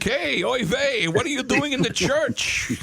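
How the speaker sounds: put-on high, slow Yiddish accent kvetch; Jackie Mason voice. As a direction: Borscht Belt Yiddish accent shtick. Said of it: "Now I sound like".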